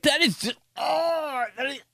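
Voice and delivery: high, gagging disgusted voice; high mock-disgusted mom. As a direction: play mom nauseated by the smell. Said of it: "She was like".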